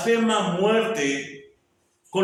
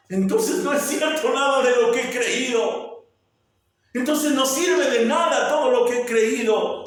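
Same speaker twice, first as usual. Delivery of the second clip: higher, louder stunned realization; Paul's realization; 9 semitones higher, 6 dB louder than usual. Retold or imitated. Preacher voicing Paul realizing his faith failed, raised dismay. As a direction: voice Paul's dawning shock.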